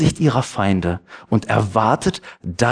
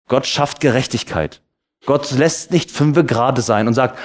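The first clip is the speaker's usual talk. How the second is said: normal talking voice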